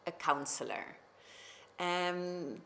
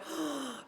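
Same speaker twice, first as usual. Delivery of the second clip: pained gasp; 7 semitones higher, 7 dB quieter than usual. Acted. King sees his injured arm.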